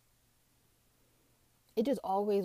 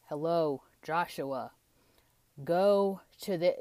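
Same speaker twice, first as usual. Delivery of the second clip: lightly voiced God, slow and spaced out; mock God voice; slower than usual. God calling a believer by name, mock solemn voice. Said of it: "it's not like he'll be like".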